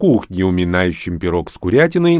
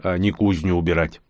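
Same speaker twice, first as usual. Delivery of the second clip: usual speaking voice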